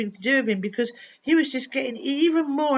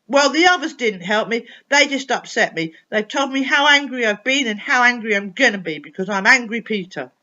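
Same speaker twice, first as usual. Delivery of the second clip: put-on louder, bitter child's grumble; loud angry complaint; 8 dB louder than usual. Angry boy complaining that nobody helps him. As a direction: complain hotly to the superpower.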